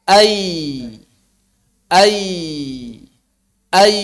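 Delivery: ai gently open; ya demo. Shown as a demonstration. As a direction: say ai loose. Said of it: "demo of ya". (contoh ya)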